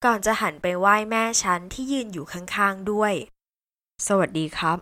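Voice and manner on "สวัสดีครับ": put-on low, slow polite young man; deep greeting. A narrator's reading. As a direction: greet her parents in a low voice. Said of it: "เสียงทุ้มเอ่ยทักทาย".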